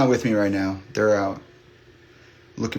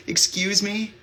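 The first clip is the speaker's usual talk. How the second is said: put-on high, tentative child's question; little kid; 11 semitones higher, slower than usual. Little kid to crying stranger on the path, high child's voice. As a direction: imitate a small kid piping up. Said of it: "this other little kid came up".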